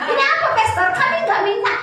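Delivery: imitating a startled, high, loud protest. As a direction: act out loud, high-pitched bewilderment. Acts out startled recipients protesting gift.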